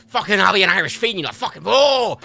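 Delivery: loud hammed-up Irish ranting; mock outburst. Streamer playing furious Irishman, loud mock rant. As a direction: shout it, ending on a big OH.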